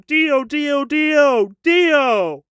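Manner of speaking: high-pitched, drawn-out name cries; mock yelling. Imitating [name]'s tangent about [name], mock yelling. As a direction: chant it in hammed-up obsession. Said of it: "he just yells".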